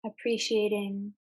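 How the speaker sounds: calm meditation guide with long, unhurried pauses; guided meditation. Real, not acted. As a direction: ease listeners into mindful breathing, phrase by phrase. Leads a guided mindfulness exercise.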